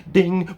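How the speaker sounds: a quick high ding, like a clerk's chime; mock ding. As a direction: chirp out a bright ding. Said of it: "they just go like".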